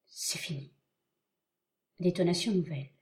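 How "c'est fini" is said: hushed and low, in shock; barely voiced, stunned to himself; stunned murmur. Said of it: "à lui-même avec stupeur".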